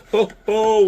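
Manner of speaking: usual speaking voice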